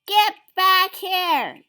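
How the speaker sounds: high, loud command; loud high call; yelled. A narrator's reading. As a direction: yell after the fleeing crowd. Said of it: "yelled Pip".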